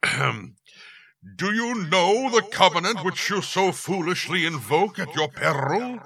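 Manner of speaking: loud, high, slow demonic boom; booming demon lord. Acted. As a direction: menace her with a rolled R. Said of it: "He rolled the R in peril".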